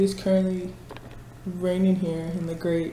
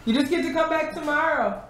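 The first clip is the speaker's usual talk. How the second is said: high, louder singsong teasing; playful high voice; 11 semitones higher, 5 dB louder than usual. Acted. Teasing coworker leaving work, high singsong. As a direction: tease them in a squeaky singsong.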